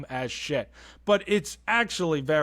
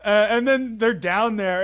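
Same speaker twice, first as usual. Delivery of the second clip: ordinary delivery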